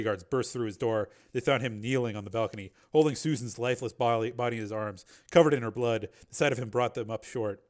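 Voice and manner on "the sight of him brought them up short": usual speaking voice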